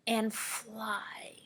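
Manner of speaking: soft breathy whisper. Real, not acted. Teacher whispers the riddle clue to kids. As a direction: drop to a secret whisper.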